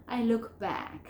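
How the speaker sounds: connected LOOK BACK, no T sound; LOOK BACK with silent T. Shown as a demonstration. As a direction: LOOK BACK linked, past ending dropped. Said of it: "Entonces, voy a pronunciar".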